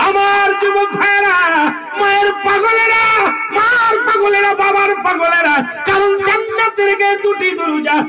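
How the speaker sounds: very high, shouted address; rally the crowd in a high shout; full-force preaching. Real, not acted. Preacher addresses mother's devoted young men, high rallying shout.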